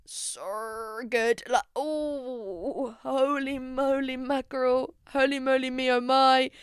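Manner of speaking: drawn-out, lingering mock swoon; exaggerated crush gushing; hammed-up swooning. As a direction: draw the words out as if swooning over someone gorgeous. Gushing over an actor's looks.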